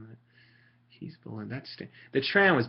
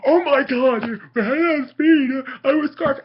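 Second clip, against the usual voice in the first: high, loud, whiny mock of a shocked opponent; whiny opponent mockery; 9 dB louder, slower than usual. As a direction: ham up a dude who can't believe he was outsped. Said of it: "They're like".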